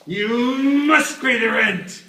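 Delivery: booming, slow, high villain voice; melodrama villain. Pretend. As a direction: loom over them and demand it. Melodrama villain demanding rent.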